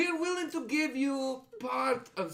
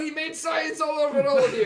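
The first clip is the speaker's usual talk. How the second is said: laughing; 3 semitones higher, 7 dB louder than usual. Real, not acted. Laughing outburst.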